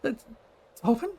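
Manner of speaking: high, halting, uncertain question; hesitant Tali. Acted. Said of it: "She looks at you, she's like".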